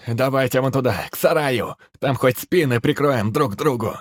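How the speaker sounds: high urgent shout to the group. Acted. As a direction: yell it over the noise. Shouting to run.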